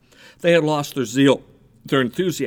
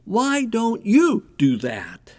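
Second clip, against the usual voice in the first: stretched, higher stress on "you"; pointed challenge; 7 semitones higher, much slower than usual. Retold or imitated. Wife to husband after watching the neighbors, pointed and slow. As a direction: drag out "Why don't you", putting the weight on YOU.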